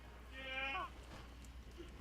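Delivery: high-pitched laugh. Real, not acted. Player laughing at a teammate knocked down, high laugh.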